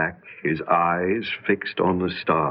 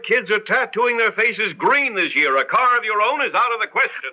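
higher, heated refusal; exasperated outburst; 12 semitones higher than usual. Acted. Father refusing son a car, exasperated outburst. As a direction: blow up at the request.

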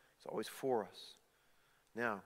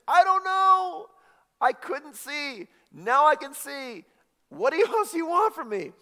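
exasperated witness; 13 semitones higher, 11 dB louder, slower than usual. Retold or imitated. Healed man grilled by Pharisees.

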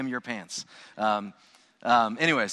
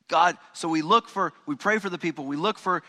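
preaching; 7 semitones higher, 5 dB louder than usual. Acted. Preaching prayer build-up.

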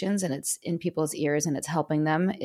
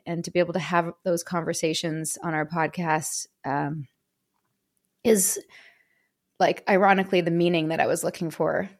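normal talking voice

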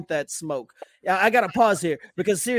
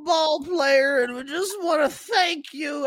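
higher, syrupy mock-sincere voice; cheesy compliment; 8 semitones higher than usual. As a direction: lay the cheese on thick. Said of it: "it's so cheesy".

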